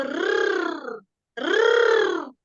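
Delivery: रर्र trilled long and strong; rolled r. Shown as a demonstration. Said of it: "देखो मैं र कैसे बोल रही हूँ".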